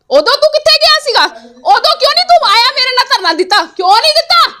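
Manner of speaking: high-pitched, indignant questions; real anger, voice pitched right up; angry confrontation. Real, not acted. Angrily confronting an absent opponent.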